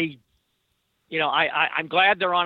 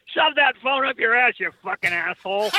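higher-pitched Boston nurse telling off a driver; Boston accent; 6 semitones higher than usual. Retold or imitated. Nurse to a horn-blowing driver at the accident, Boston accent. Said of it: "in a great Boston accent".

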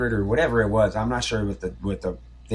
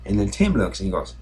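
normal talking voice

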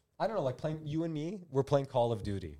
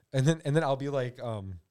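normal talking voice

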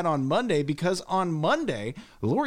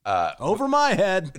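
Spicoli voice; 2 semitones higher, 4 dB louder than usual. Acted. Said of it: "doing your Sean Penn accent".

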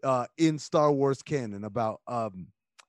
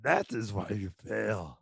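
low, soft sage impression; Yoda; 7 semitones lower, 6 dB quieter than usual. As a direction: drop the pitch and play the creature master. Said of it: "you know what Yoda is".

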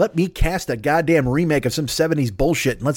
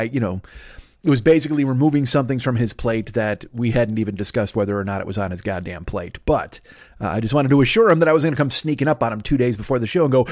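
usual speaking voice

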